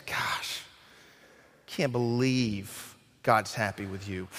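whispered, slow, bitter aside; a hint of jealous Cain muttering; envious mutter. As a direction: grumble it under your breath at your brother. Cain's jealous aside to Abel.

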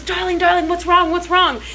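imitating a kindly older British man; sweet British man. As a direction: fuss gently over a crying stranger, high. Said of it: "British man just stops and goes".

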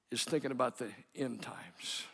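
whispered, leaning in close; hushed whisper. Acted. Preacher whispering to his congregation about the end times, hushed. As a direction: breathe it like a secret to the congregation.

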